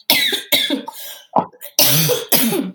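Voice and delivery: harsh coughing fit; real rough cough; smoker's cough. Real, not acted. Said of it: "it causes me to have smoker's cough".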